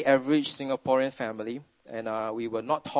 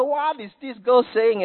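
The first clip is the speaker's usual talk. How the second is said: baffled; 11 semitones higher, 7 dB louder than usual. Retold or imitated. Father's baffled thought at dinner.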